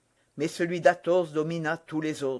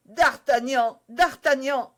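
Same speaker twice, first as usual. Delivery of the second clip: higher, louder desperate call; 7 semitones higher, 5 dB louder, slower than usual. Acted. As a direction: call to her beloved as she dies. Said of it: "s'écria madame Bonacieux".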